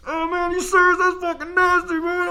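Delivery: very high, squeaky mock disgust; disgusted guy. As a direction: squeal it like a grossed-out bro. Other guy reacts, disgusted.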